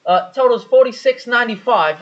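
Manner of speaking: high-pitched slip into Aussie; drive-thru worker helplessly copying an Australian accent; put-on Aussie customer-service line; mimicked Australian accent. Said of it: "talking in the accent".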